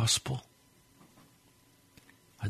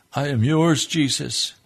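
raised, louder cry of devotion; fervent surrender; 4 semitones higher, 5 dB louder than usual. Real, not acted. Preacher giving himself to Jesus, fervent surrender. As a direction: declare it out to God, higher and stronger.

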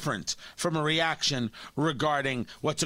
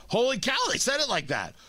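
higher, astonished realization; stunned amazement; 7 semitones higher than usual. Retold or imitated. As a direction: gasp it out as the shock sinks in. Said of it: "And then you're like".

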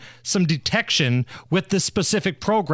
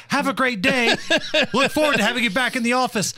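mocking reply-all coworker; 6 semitones higher, faster than usual. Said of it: "the jagoffs that reply all".

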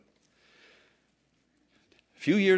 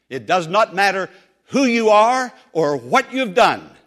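louder, higher and firm but kind; firm maitre d'; 6 semitones higher, 12 dB louder than usual. Retold or imitated. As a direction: stop her politely but without give. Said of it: "the maitre d' firmly but kindly said".

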